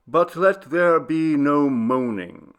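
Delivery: slow, grave quoting of a poem; a lightly voiced old man reciting; solemn recitation. A narrator's reading. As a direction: intone it like verse, slowly. Archelaus quoting Tennyson gravely.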